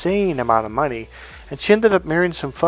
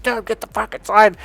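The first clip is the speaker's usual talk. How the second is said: high, louder yell to get inside; retold yell; 9 semitones higher, 3 dB louder than usual. Yelling at his friend to get inside before the dog bites. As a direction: shout the warning now, urgent.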